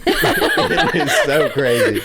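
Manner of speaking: high, squeaky-with-laughter and drawn out; real laughter. Real, not acted. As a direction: say it slowly while cracking up. Cracking up at friend's amazement.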